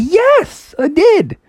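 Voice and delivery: very high, eager yes; excited Froggy. Acted. Froggy tells the house he read, high and triumphant. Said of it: "And Frog's like".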